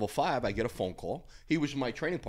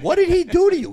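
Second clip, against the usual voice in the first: much higher and louder, an exasperated yell; loud demand; 15 dB louder than usual. Retold or imitated. Larry to his raging training partner, a high exasperated yell. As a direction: yell it like a frustrated big brother. Confronting friend in gym.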